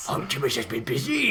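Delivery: gruff pirate impression of the line. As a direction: say it like a swashbuckling pirate. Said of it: "You sounded like a pirate then".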